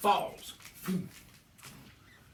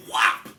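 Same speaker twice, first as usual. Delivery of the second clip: loud punchy kick noise; loud kick whop; 15 dB louder than usual. Acted. Comic kicking the city boy, a loud whop. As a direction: slam out the sound of a boot. Farmer's kick sound effect.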